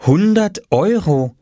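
slow, high-pitched amazement; astonished. A narrator's reading. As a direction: read it out in astonishment. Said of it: "und staunte". (erstaunt und gedehnt)

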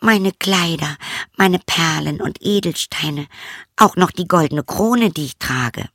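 the princess offering all her treasures; eager princess. Acted. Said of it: "sagte sie".

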